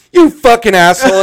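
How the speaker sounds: loud, high mock-furious outburst; retold lashing out. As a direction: imitate an angry coworker cursing you out. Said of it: "He started just lashing out at me".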